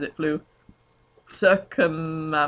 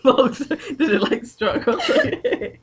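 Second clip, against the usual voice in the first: laughing; 8 semitones higher, 4 dB louder than usual. Real, not acted. Laughing at fanfic.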